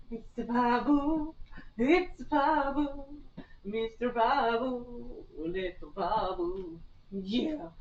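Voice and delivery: slow, soft cooing of the name; tender cooing. Real, not acted. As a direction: croon the name slowly.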